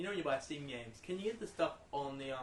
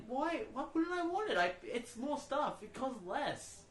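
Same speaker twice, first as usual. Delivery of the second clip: incredulous; 10 semitones higher, slower than usual. Retold or imitated. Baffled retort to shop clerk.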